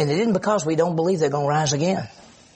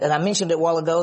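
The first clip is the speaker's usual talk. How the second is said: usual speaking voice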